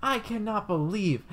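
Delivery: hammed-up indignant woman; mock offended lady. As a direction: whine it like the offended classmate. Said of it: "she goes".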